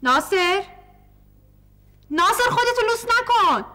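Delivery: high, wary calls to the door; calling through door. Acted. Mother and daughter calling to someone behind the door, high and wary. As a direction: call out high, afraid to open.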